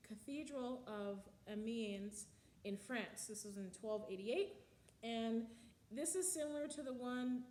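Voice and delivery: ordinary talk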